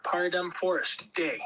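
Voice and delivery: ordinary talk, nothing marked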